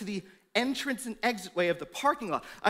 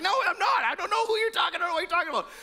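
high, loud, rushed denial; panicked denial; 9 semitones higher, 4 dB louder, much faster than usual. Retold or imitated. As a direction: blurt it out in alarm. Peter denying Jesus in panic.

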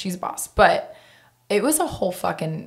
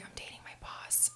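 hushed whisper of a confession; a girl confiding her secret in a whisper; 21 dB quieter than usual. Retold or imitated. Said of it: "can I tell you a secret".

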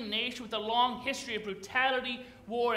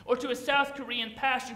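ordinary talk, nothing marked

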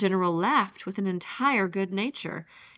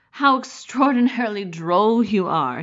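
louder, higher burst of amusement; highly amused; 4 semitones higher, 9 dB louder than usual. A narrator's reading. General mocking his guest's scruples, loudly amused. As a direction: exclaim it, hugely tickled by the young man. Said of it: "Laughter shook the general".

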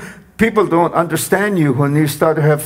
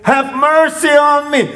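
shouted plea for mercy; 9 semitones higher than usual. Retold or imitated. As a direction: call out with everything, begging. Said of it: "exactly the similar way".

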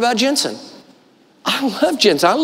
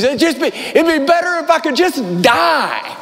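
raised, strained cry of despair; anguished prayer; 7 semitones higher than usual. Retold or imitated. Elijah crying out to God in despair, anguished. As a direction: plead to die, voice pushed up high.

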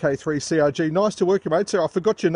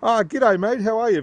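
high, loud, eager hello over the radio; thrilled first contact greeting; excited greeting; 9 semitones higher, 4 dB louder than usual. Real, not acted. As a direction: greet him like you've waited all night.

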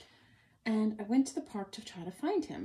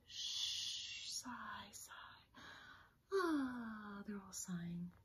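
soft sighing whisper; 2 semitones higher, 8 dB quieter, much slower than usual. Acted. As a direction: breathe it out like sleep. Sleeping dogs sighing, hushed.

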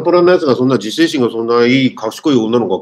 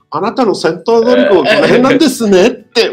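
Frieza impression; 11 semitones higher, 4 dB louder than usual. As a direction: talk down to them like a smug alien tyrant. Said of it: "フリーザの第三形態みたいに". (高く遅い、見下したフリーザの声真似)

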